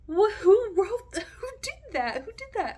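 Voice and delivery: high, loud stunned "who did that?"; shocked outcry. Retold or imitated. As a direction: blurt it out in disbelief. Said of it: "we're just, like, all like".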